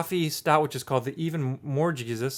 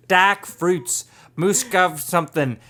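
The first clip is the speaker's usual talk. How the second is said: slow mock British-Australian; British accent turning Australian; 3 semitones higher, 4 dB louder, slower than usual. Host stumbles through the label, mock British-Australian accent. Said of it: "with a British accent".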